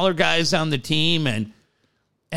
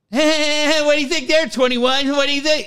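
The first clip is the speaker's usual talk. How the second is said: shouted sideline taunt, pitched up; mock trash talk; 10 semitones higher than usual. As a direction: heckle like a cocky fourteen-year-old. Kid trash-talking from sideline.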